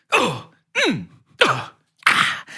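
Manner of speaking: grunts of being hit and hitting; short game-combat effort noises. Shown as a demonstration. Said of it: "being hit, hitting".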